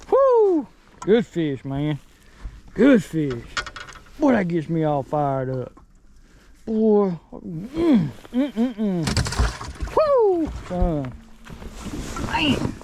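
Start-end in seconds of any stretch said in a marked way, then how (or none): 0.1-0.7 whoop. Real, not acted. Cheering the fish. 10.0-10.5 whoop. Real, not acted. Cheering the fish.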